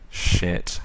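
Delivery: normal talking voice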